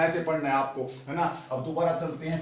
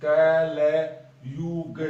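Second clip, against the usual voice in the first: कलयुग held very long and louder; drawn-out keyword; 5 dB louder, much slower than usual. Shown as a demonstration. Teacher giving students a memory trick, stretching कलयुग long and loud. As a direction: stretch कलयुग out and lean on it hard. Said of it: "देखो लूणकरण के अंदर".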